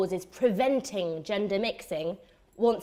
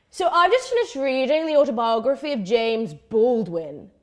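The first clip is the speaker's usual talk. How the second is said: louder put-on voice of a would-be intellectual; posturing activist; 6 dB louder than usual. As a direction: mimic an activist showing off his reading. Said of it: "He prefaces his introduction with".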